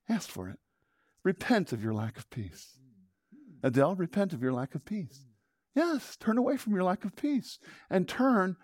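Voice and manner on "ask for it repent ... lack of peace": low, soft urging to repent; gentle plea. Acted. Pastor gently urging the congregation to repent. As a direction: coax them gently toward peace.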